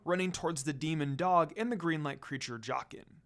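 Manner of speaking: usual speaking voice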